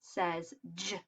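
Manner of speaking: J said as a short "juh"; letter sound. Shown as a demonstration. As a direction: give J its phonics sound, "juh". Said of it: "We know the letter J".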